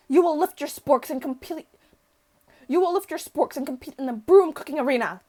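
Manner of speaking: hammed-up professor rallying the class; grand, emphatic oratory with loud peaks; rousing speech. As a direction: build to a booming battle cry. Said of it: "interrupts the professor's rousing speech".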